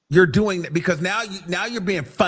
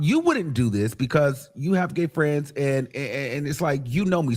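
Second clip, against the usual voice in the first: usual speaking voice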